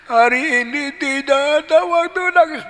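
high, loud mock bawling; wailing child. Child repeats father's line, high mock wail. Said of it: "Nangis lagi".

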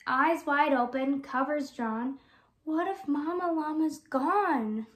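usual speaking voice

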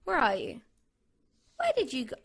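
ordinary delivery

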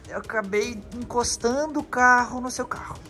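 ordinary talk

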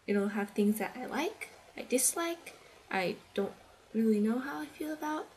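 ordinary talk, nothing marked

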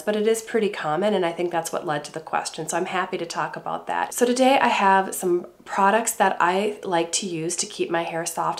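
ordinary talk, nothing marked

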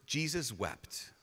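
slow and soft; solemn reading. Real, not acted. Preacher reading the shortest verse, slow and hushed. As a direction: let the two words land gently.

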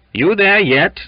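high, loud, threatening call. A narrator's reading. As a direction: challenge him with menace. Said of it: "in a threatening tone".

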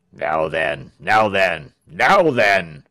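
quick stern governor. A narrator's reading. Governor riding up to scattered rebels, stern and low. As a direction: challenge them low, mounting in force. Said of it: "he said in his quick".

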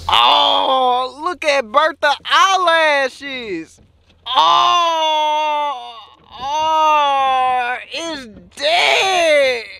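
high, drawn-out moaning wail; wailing lament. Acted. Vlogger finding Bertha dead, wailing lament. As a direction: stretch each oh into a mournful cry.

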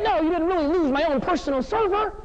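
high-pitched mock outrage; acted indignant disbelief. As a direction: play up the scandalized inner protest. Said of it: "I'm thinking thoughts like".

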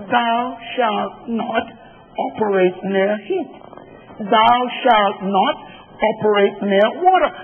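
slow, drawn out like scripture; mock solemn warning. Preacher reading an appliance manual as scripture, slow and solemn. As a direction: read the iron's warning label like a commandment.

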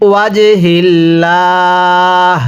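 very slow drawn Quranic reading; slow recitation. Shown as a demonstration. Teacher reciting the next word to students, slow chant. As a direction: stretch every syllable.

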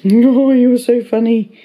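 loud, delighted gush at the dog. Real, not acted. Owner gushing loudly to her funny dog. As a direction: burst out in fond delight.

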